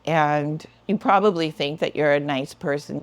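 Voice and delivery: usual speaking voice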